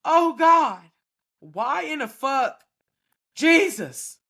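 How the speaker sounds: high loud mock moaning cries to God; mock sex cries. Imitating a woman crying out to God mid-sex, loud and high. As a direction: ham up the bedroom screaming. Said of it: "y'all be like".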